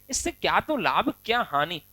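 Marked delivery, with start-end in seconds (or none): none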